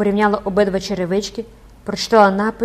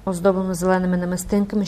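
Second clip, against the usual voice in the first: ordinary delivery, nothing marked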